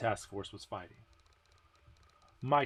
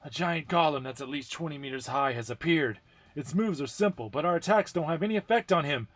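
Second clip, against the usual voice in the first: higher, louder, fast urgent report; desperate radio voice; 7 semitones higher, 7 dB louder than usual. Acted. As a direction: report the golem in a rush of worry. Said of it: "how desperate the voice on the radio was".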